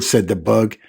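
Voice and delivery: ordinary delivery, nothing marked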